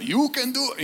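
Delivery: put-on high, Braveheart-style English accent; a playful stab at a Scottish-hero English accent; mock English accent. Preacher voicing the Holy Spirit, light mock English accent. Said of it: "with that English accent".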